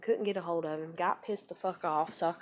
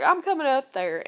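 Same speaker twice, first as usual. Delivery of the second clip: loud, high retold announcement; firm defiance; 7 semitones higher, 10 dB louder than usual. Girlfriend telling boyfriend she is coming, defiant. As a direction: re-enact laying it down, voice raised.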